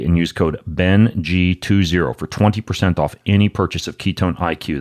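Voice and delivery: ordinary talk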